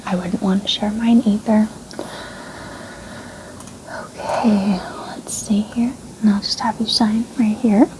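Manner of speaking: ordinary talk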